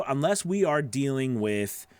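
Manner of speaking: ordinary talk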